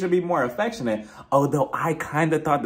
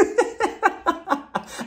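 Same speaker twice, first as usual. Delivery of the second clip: real high laugh; 12 semitones higher than usual. Real, not acted. Laughs at Brooks.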